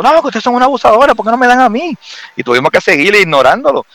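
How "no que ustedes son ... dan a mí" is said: retold angry man, much higher and fast; angry complaint. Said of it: "Entonces el tipo". (queja enojada, aguda y rápida, imitando al hombre)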